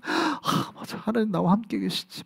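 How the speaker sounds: a soft breathy sigh of sudden recognition, then hushed and quiet; moved realization. Shown as a demonstration. Preacher acting a moved believer remembering, hushed and tender. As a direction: whisper it as if deeply touched. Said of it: "막 이렇게 되는 거예요".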